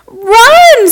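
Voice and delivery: high, loud panicked scream; one frantic shrieked word. Acted. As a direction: yell it as you bolt away. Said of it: "screamed Janice".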